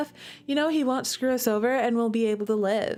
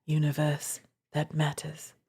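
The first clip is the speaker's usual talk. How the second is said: lower, soft, whispered begging; a broken man clinging on; pleading whisper; 5 semitones lower, 4 dB quieter than usual. Acted. Voices Zor pleading, crying.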